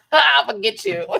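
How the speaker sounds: high, loud, through laughter; laughing mock threat. Interviewer cornering her guest, imitated while laughing. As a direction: crack up while playing the interviewer.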